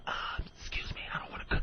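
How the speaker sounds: tiny, timid whisper. Acted. Passenger quietly begging off the flight, acted whisper. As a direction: whisper a polite refusal. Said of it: "I'm gonna whisper".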